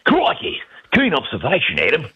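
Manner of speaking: hammed-up Aussie in Steve Irwin style; Australian accent. Shown as a demonstration. American actor showing the radio hosts his Aussie accent. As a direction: put on a big, excitable Australian accent. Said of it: "can we hear that accent?".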